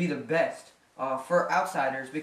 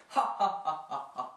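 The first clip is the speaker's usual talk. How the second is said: forced laugh; 3 dB quieter than usual. Acted. Trajan laughs off the controversies.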